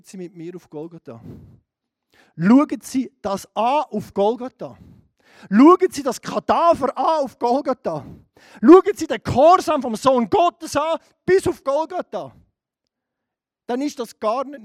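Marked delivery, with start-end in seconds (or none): 2.4-12.3 full-force preacher build-up; fiery preaching. Acted. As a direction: preach it, rising with each line.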